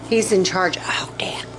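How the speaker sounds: hushed whisper, almost no voice. Real, not acted. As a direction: lean in and whisper it as a secret. Hushed aside about the doctor.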